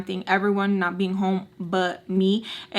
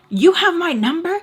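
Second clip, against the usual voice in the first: challenging yell; 9 semitones higher, 7 dB louder than usual. Retold or imitated. Retold challenge to the rival.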